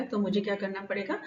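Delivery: ordinary delivery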